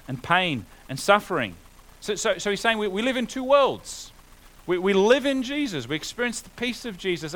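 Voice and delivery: rising preacher's fervour; preaching at full force. Acted. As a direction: proclaim it to the congregation.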